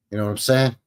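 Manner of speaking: ordinary talk, nothing marked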